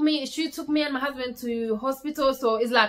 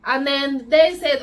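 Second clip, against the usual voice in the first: ordinary talk, nothing marked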